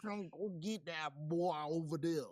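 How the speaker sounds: bad Southern accent on request; put-on Southern accent. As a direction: play a Tennessee tough guy. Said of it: "Bubba's Southern maga country accent".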